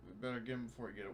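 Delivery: usual speaking voice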